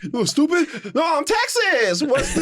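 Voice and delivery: high, slow mock indignation; mock SpongeBob. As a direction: play it up high and drawn out. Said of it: "Texas episode".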